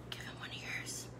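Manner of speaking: breathy whispered aside. Acted. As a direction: whisper it gently like a secret. Soft closing advice to viewers.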